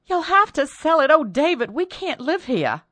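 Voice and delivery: high, loud begging; desperate to flee the house; pleading wife. Acted. Wife begs to sell house.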